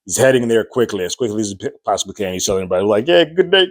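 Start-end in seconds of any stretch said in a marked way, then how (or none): 3.1-3.7 lightly voiced cheery greeting, higher; breezy showman hello while rushing by; a hint of the host's catchphrase voice; Joe's catchphrase. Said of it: "saying all of his catchphrases".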